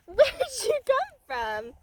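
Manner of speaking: high, slow, laughing coo of "Where'd you come from?". Real, not acted. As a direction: tease the kitten fondly, stretching the words. Same question, cooed.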